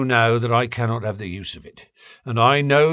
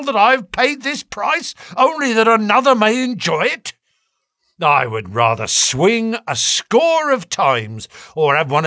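rising furious outburst; impassioned; 7 semitones higher, 3 dB louder than usual. A narrator's reading. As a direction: pour out the grievance, building. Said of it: "came out in a wild whirl of words".

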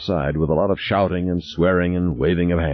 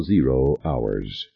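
usual speaking voice

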